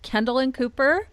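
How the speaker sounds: ordinary talk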